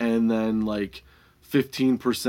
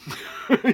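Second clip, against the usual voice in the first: laughter; 11 semitones higher than usual. Real, not acted. Laughing after the punchline.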